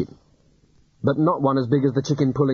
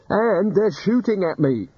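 panicky whine; 8 semitones higher, slower than usual. Acted. Rincewind panics under fire.

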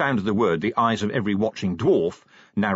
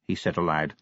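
plain reading voice

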